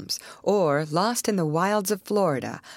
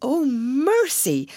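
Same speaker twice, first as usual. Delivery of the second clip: high, slow, drawn-out cry; a mild, stretched-out scream of horror; horrified cry; 8 semitones higher, much slower than usual. A narrator's reading. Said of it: "screamed Miss Pennington".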